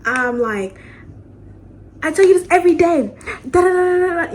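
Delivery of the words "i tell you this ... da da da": higher, much louder shouted retake; full yell. Retold or imitated. Redoing the yell at her mother properly, loud shout. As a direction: let the scold rip at full volume. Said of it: "I'm talking so quiet now".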